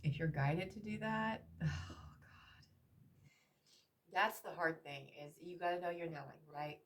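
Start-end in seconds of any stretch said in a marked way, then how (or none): 1.6-2.7 a breathy sigh, then oh God under the breath; tired sigh into a whisper; weary whispered sigh. Real, not acted. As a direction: exhale the weight of it. Overwhelmed by the feelings.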